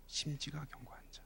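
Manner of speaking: whispered, soft echo; hushed emphasis. Real, not acted. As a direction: breathe the phrase to them. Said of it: "whispered echo".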